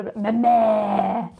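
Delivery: slow, loud mock French "ma mère"; hammed-up imitation of French speakers; mock French accent. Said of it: "They sort of like".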